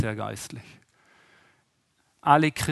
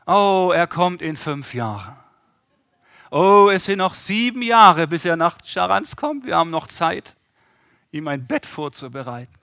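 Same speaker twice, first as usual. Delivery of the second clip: mock complacent voice; 3 semitones higher, 7 dB louder than usual. Mocking a lazy slow believer.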